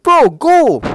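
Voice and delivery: high, loud, frustrated shooing; exasperated urging. Retold or imitated. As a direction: act out hurrying someone along, fed up. Exasperated retort in retold argument.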